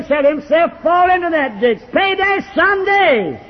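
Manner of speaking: hoch und kraftvoll gepredigt, am Ende langsam; preaching at full force. Acted. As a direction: ring it out like a revival preacher. Preaching scripture warnings at full force.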